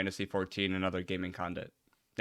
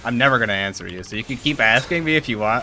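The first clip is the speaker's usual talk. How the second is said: teasing; 4 semitones higher, 8 dB louder than usual. Real, not acted. Teasing a friend's repeated question.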